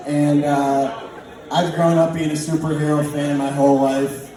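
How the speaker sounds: normal talking voice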